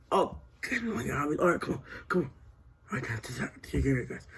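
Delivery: ordinary talk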